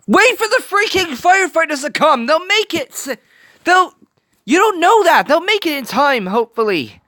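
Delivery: yelling at the screen. Real, not acted. Viewer begs Tadashi to wait for the firefighters, high urgent yell. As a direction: shout advice at a character who won't listen.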